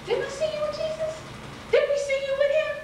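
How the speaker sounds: high, pointed accusation; accusing bystander. Retold or imitated. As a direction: call him out, voice up high.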